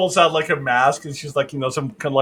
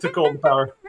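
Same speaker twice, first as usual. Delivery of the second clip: ordinary talk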